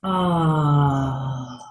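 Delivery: long audible exhale on "ah"; open, sustained "ah" falling away; sigh of release. Shown as a demonstration. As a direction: let it all go in one drawn-out sigh. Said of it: "Feel the real release of that".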